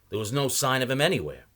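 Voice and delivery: plain reading voice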